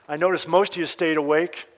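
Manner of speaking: normal talking voice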